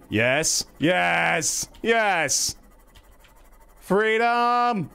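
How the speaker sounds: rising, high jubilant cries; elated escape, ending in a high cry; triumphant. Real, not acted. Escapes the trap in game.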